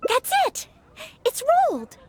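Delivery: excited realisation. Acted. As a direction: blurt it out, delighted. Realising the answer to quiz.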